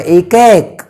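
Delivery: एक with the ऐ vowel drawn out wide; stretched ai sound. Shown as a demonstration. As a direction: stretch the vowel of एक left and right into ऐ. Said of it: "ए को आप जब stretch करेंगे". (ऐ की ध्वनि खींचकर उच्चारण)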